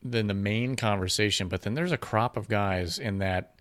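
usual speaking voice

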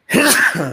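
sudden sharp sneeze; a real sneeze caught on the mic; sneezing. Real, not acted. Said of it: "I just sneezed right".

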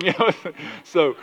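normal talking voice

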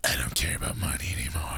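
defeated whisper. Acted. The hero turning on his boss, whispered.

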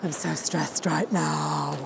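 low, soft, slow weary sigh of a stressed person; mock stressed moan. Mocking how we sound when we say we're stressed. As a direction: droop into a tired complaint. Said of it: "How often do we say the word stress?".

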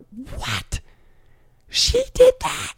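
very high, incredulous squeak; mock disbelief. Onlooker seeing what she did, squeaky disbelief. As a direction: shoot way up in pitch, stretched out, astonished.

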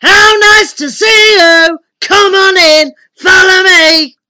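loud, high bellowed welcome; booming giant. A narrator's reading. Giant wizard welcomes a small visitor in, booming. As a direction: boom every phrase like a giant host. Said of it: "he boomed in a voice that made my head spin".

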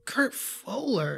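slow, stretched echo of the name; mock-savour the name slowly; playfully elongated repeat; drawn-out name.

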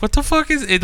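very high mimicked squeal; laughing girlfriend imitation. Girlfriend asks about the ring, imitated laughing squeal. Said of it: "she keeps laughing".

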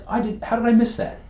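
high, stunned self-quote; amazed disbelief. Retold or imitated. Telling a friend about the coach news, high amazed disbelief. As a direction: act floored you missed the news. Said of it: "And I'm like".

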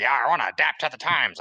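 loud, high, hammed-up Southern accent; mock Southern accent. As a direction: belt out a mocking fake Southern twang. Mocking someone faking a Southern accent.

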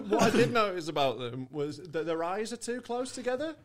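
high and loud, laughing through the words; laughing while speaking. Real, not acted. Laughing as he tells friends about the locals.

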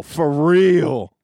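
For real said fur real, loud and high; mock street fur real. Shown as a demonstration. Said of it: "F U R R E A L".

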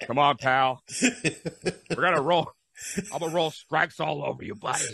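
lightly put-on wrestling boss swagger; a hint of a blustering boss impression; Vince McMahon. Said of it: "Let me use my Vince McMahon voice".

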